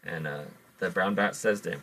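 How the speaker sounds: usual speaking voice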